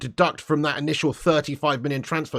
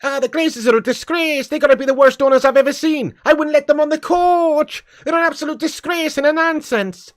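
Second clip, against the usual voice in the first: much higher, Irish-flavoured rant voice; Roy Keane impression; 10 semitones higher, 4 dB louder than usual. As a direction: growl out a disgusted pundit rant as Roy Keane. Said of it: "Roy Keane should be throwing punches".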